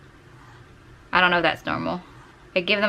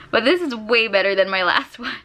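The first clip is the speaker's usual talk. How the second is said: ordinary talk, nothing marked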